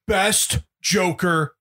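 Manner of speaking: very slow, drawn-out proclamation; slow mock pronouncement; imitating theater talker. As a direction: draw out each word like dad's verdict. Imitating dad talking in theater.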